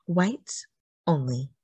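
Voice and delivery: ordinary delivery, nothing marked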